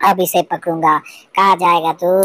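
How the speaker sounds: usual speaking voice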